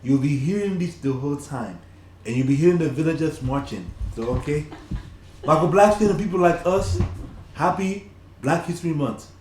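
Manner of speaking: put-on playful Nigerian accent. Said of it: "I am talking like this, my Nigerian accent".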